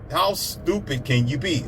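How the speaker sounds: normal talking voice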